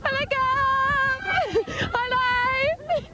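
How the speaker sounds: high, drawn-out calls; calling out. Real, not acted. Reporter trying to get a passing star to notice, calling out. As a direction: holler for the star's attention, pitched well up.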